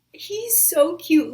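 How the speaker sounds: high, loud, slow squeal of delight; fangirl squeal. Real, not acted. Fan gushing over a sweet character, high loud squeal. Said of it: "I'm like".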